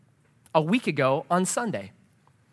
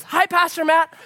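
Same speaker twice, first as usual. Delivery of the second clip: high, louder friendly call; imitated barista greeting; 12 semitones higher, 5 dB louder than usual. Barista greeting the pastor she recognises, imitated high call. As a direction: lightly mimic a barista calling hello.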